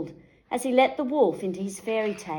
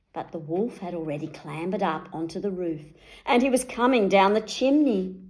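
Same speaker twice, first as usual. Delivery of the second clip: ordinary delivery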